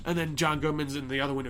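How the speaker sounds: normal talking voice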